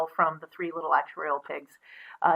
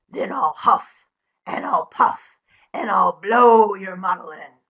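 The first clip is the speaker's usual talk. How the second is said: louder, slow menacing wolf; slow growled wolf threat; 5 dB louder than usual. Acted. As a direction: threaten like a big bad wolf.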